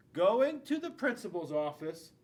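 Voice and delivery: ordinary delivery, nothing marked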